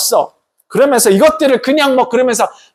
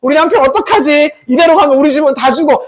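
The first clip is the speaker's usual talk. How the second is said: higher-pitched fretting wife; acted worry, pitched up and agitated; worried wife; 6 semitones higher than usual. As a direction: fret aloud over a husband in trouble. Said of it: "걱정이 돼".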